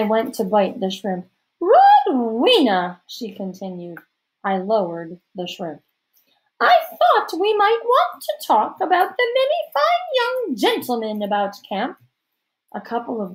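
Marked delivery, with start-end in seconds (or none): 1.6-3.0 loud, high, long drawn-out name; imperious society-lady summons; grand hostess. A narrator's reading. Hostess grandly addressing her guest. 6.6-12.0 grand hostess. A narrator's reading.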